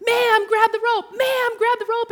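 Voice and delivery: high, urgent rescuer's shout; yelling. Retold or imitated. Rescuers to the woman in the river, yelling. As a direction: yell it high, twice over. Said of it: "and they're yelling".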